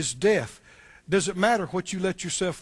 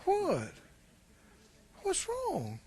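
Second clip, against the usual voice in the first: mock coaxing wife; 2 semitones higher, 6 dB quieter than usual. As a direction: tease like the wife leaning in. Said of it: "she said to him".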